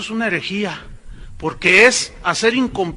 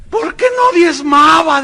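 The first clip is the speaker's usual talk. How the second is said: high, loud, drawn-out wail; hammed-up cry of the damned; mock anguished shout; 8 semitones higher, 8 dB louder, slower than usual. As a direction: imitate her slow, shouted reply. Damned woman's acted reply in testimony.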